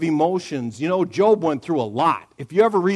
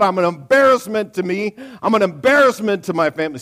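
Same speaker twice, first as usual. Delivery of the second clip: louder and higher, blaming God; angry complaint prayer; 4 semitones higher, 4 dB louder than usual. Shown as a demonstration. Preacher showing the wrong way to pray, a loud angry rant.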